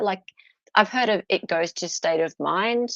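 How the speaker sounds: ordinary delivery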